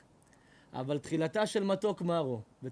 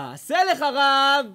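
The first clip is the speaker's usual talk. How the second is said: drawn out, louder, higher cry; slow loud proclamation; 8 semitones higher, 13 dB louder, much slower than usual. Acted. Preacher crying out the saying to his congregation, slow loud proclamation. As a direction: call it out slowly to the hall.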